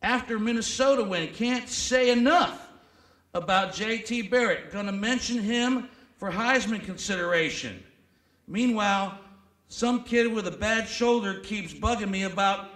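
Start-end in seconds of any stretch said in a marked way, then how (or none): 0.0-11.7 loud, hammed-up reading of a coach's diary; mock coach voice, played big for laughs; mock coach journal. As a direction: read it like a pompous coach's journal entry.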